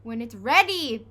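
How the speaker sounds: loud snapped answer; bellowed. A narrator's reading. Dad bellowing back at hungry Henry, loud. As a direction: bark it back at the boy. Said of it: "bellowed Dad".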